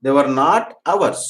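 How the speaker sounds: slow and clear, stressing not; slow dictation. Shown as a demonstration. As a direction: dictate it slowly, leaning on not. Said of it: "teacher dictating negative slowly". (నెమ్మదిగా, not ని ఒత్తి)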